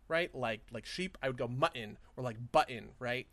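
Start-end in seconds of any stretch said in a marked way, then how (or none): 1.5-2.0 "mutton" with a glottal stop for the T. Shown as a demonstration. Podcaster showing non-linguists a glottal stop, "mutton" with the T unpronounced. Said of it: "I'm not actually pronouncing a T there". 2.5-2.9 "button" with a glottal stop for the T. Shown as a demonstration. Podcaster showing non-linguists a glottal stop, "button" with the T unpronounced. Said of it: "I'm not actually pronouncing a T there".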